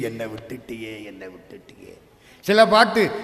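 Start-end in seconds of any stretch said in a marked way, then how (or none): 0.0-1.9 softer and lower, fading to a broken murmur; fading lament. Acted. Devotee begging God not to leave him, fading into grief. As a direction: let the plea die away in grief.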